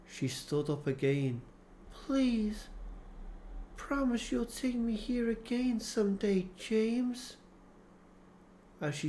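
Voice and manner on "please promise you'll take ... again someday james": Mary pleading. A narrator's reading. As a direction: plead gently, voiced high as Mary.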